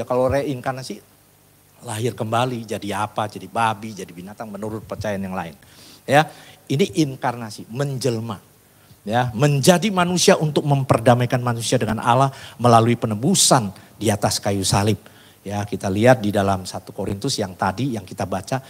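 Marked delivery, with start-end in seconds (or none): none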